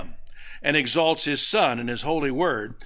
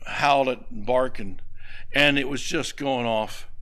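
usual speaking voice

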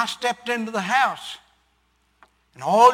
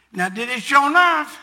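eager question; 5 semitones higher, 6 dB louder than usual. Retold or imitated. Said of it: "wife's excited question".

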